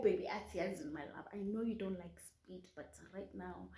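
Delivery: ordinary talk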